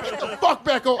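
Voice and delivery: high snapped order with a laugh; imitating an angry policeman; mock cop bark. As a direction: play the cop shoving him back.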